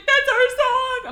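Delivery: high, loud, drawn-out mock wail; jealous outrage. Fifi hearing about the serenade, hammed-up outraged wail. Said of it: "She's like".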